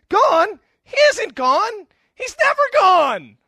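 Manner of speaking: very loud, high frantic yelling, drawn out; exploding. Acted. Doctor finding Bob still in the doorway, exploding in a shout. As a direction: blow up completely, stretching the words in outrage. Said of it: "Doctor Marvin explodes".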